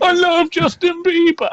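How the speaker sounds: put-on high, slow blubbering little girl; imitating crying kid. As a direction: wail like a sobbing young fan. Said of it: "that little kid ... starts crying".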